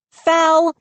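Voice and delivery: high sharp cry of protest; a girl crying foul at the ping pong table; cried out. A narrator's reading. High indignant cry. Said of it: "Mindy cried".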